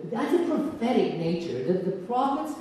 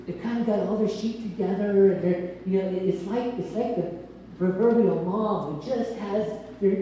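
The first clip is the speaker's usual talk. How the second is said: ordinary talk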